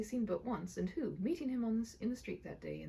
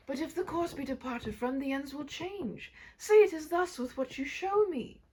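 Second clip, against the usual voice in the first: louder, higher pleading; desperate plea; 6 semitones higher, 9 dB louder than usual. Acted. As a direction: beg the spirit, rising to a cry. Scrooge begging the spirit.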